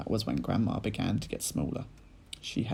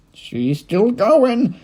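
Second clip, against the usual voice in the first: loud, very slow gleeful call; gleeful shout; 13 semitones higher, 13 dB louder, much slower than usual. A narrator's reading. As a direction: crow it out with glee. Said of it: "shouted Mister, Mister Cranky gleefully".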